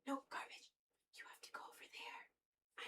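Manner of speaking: breathy whisper to the cat; whispered coaxing, keep it secret. Real, not acted. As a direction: hush it, shoo the cat away quietly.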